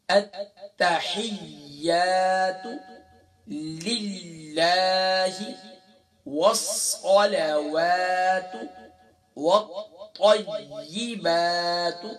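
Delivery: slow, drawn-out chanted recitation. Shown as a demonstration. Teacher reciting the tashahhud for students, slow and chanted. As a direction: intone each phrase slowly like a prayer.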